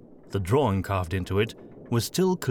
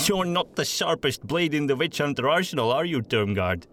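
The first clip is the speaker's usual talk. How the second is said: mocking; 7 semitones higher than usual. A narrator's reading. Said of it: "he said, ignoring the man's venomous look".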